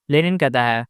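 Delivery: ordinary delivery, nothing marked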